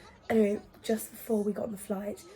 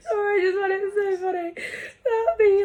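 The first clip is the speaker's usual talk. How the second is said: laughing; 9 semitones higher, 4 dB louder than usual. Real, not acted. Speaker cracking up.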